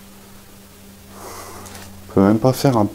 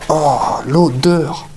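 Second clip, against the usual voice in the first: slow, higher, nauseated groan; disgusted groan; 5 semitones higher, much slower than usual. Real, not acted. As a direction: groan at the stench. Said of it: "je vais vomir".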